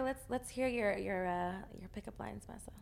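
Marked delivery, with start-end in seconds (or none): 1.8-2.7 teasing whisper. Real, not acted. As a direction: drop to a whisper on it. Teasing host prompt.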